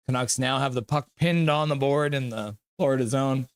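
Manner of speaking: announcer calling the clock; play-by-play. Acted. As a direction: count down the power play like an announcer. Live hockey play-by-play.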